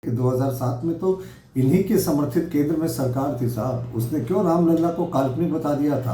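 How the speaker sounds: usual speaking voice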